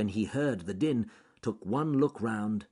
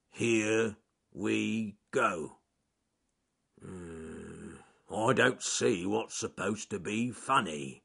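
very slow, puzzled growl; growly bear; much slower than usual. A narrator's reading. Charles Growly Bear repeating the joke to himself, slow puzzled growl. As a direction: drag the joke out, baffled.

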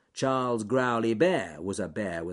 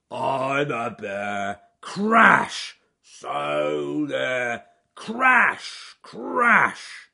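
loud bear bellow against high thunder crashes; roaring contest; 6 semitones higher, 10 dB louder, much slower than usual. A narrator's reading. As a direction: roar it out slowly, crashes shouted higher. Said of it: "roaring away".

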